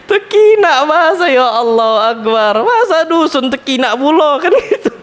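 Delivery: real laughter over the funny village word; laughing. Real, not acted. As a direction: keep laughing while you speak.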